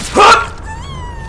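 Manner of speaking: loud, very high startled gasp; startled yelp. Real, not acted. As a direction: yelp out in sudden shock. Startled cry during play.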